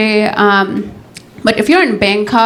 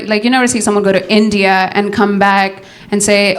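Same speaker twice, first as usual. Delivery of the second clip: usual speaking voice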